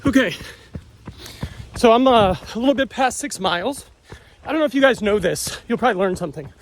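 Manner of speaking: talking mid-run, a bit winded; while running. Real, not acted. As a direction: speak while jogging along. I'm holding this phone, talking to you.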